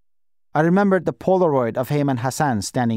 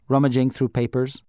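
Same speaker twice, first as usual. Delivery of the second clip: plain reading voice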